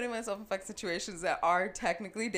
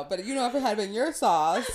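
louder, laughing jab; laughing tease; 3 semitones higher, 8 dB louder than usual. Real, not acted. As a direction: poke fun while laughing. Teasing friend about sauce.

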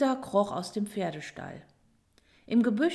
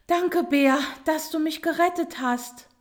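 high, loud, breathless thanks; out of breath after a fright; gasping; 7 semitones higher, 5 dB louder than usual. A narrator's reading. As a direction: gasp out your thanks. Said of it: "japste die Schildkröte".

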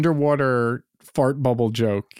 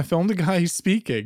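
ordinary talk, nothing marked

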